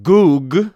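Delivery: "Gug" with the vowel as in "food", punched out; U-sound pronunciation. Shown as a demonstration. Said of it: "more of a U sound".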